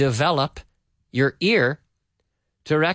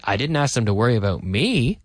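"me" stressed at the end, then falling; emphasis saved for "me"; 4 semitones lower than usual. Shown as a demonstration. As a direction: land hard on the final word "me". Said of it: "the intonation is very important".

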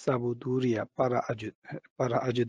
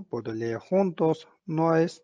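normal talking voice

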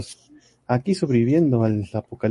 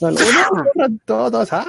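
slow, high mock alarm cry; hammed-up call to flee; mock panic; 11 semitones higher, 3 dB louder, much slower than usual. Mock alarm after a cough.

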